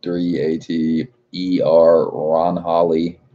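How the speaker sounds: slow, spelled-out reading of a viewer name; sounding out a name. Real, not acted. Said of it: "Sorry if I butchered your name".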